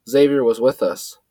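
ordinary delivery, nothing marked

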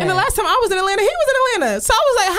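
fast incredulous storytelling; exasperated telling. Real, not acted. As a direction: pile on the outrage as it builds.

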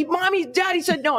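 put-on high, whining child; whiny kid voice. Mom imitating her son tattling, high whine. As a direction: whine it up high like a little boy. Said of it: "he's like".

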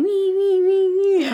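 high-pitched fake crybaby wailing; exaggerated "wee-wee" boo-hoo; mock crying. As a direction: squeal out a teasing imitation of crying. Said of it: "You were crying".

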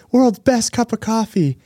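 "World's best cup of coffee" squealed very high and slow; Elf. Retold or imitated. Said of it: "he's like".

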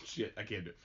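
ordinary delivery

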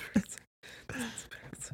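slow breathy giggling whisper. Pretend. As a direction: drag it out in a whisper. Joking conspiracy whisper.

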